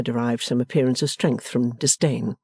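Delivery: ordinary delivery, nothing marked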